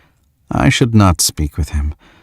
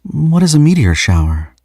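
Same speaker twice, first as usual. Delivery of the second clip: high, sweet innocent question; feigned innocence; 9 semitones higher than usual. A narrator's reading. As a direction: play coy and naive.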